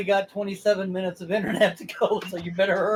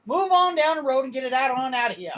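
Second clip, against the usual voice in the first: higher-pitched Southern yokel shooing someone off; southern accent; 9 semitones higher, 6 dB louder than usual. Shown as a demonstration. Friend's turn to do a Southern accent on request, Southern accent. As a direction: play a grumpy Southern local chasing a stranger away, Southern accent. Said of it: "Southern accent".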